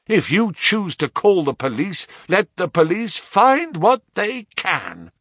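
high-pitched, heated challenge; passionate defiance. A narrator's reading. Son dares father to call the police, passionate. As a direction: dare him with real heat. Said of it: "with a passion such as".

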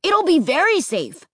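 put-on high-pitched emphatic boy; declaring. A narrator's reading. Boy overrides Mom's worry, insistent declaring. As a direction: insist with weight on VERY. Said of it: "Luke declared".

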